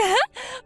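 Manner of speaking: quick high smug giggle; gloating laugh. Acted. Sister-in-law boasting about the prank, gloating laugh. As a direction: laugh in her face.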